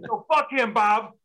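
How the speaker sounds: high, loud angry retort; imitating the old guy's angry refusal. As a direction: spit it back defiantly.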